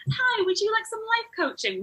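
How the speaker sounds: high, chirpy sales greeting; eager coach pitch. Pretend. Coach cold-pitches a stranger, high eager chirpy offer. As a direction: sing it out like a too-keen life coach.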